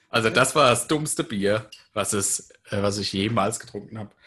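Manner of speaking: loud, exasperated rant; frustrated outburst. Real, not acted. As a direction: vent the frustration loudly. Frustrated, can't open beer. (genervt und laut)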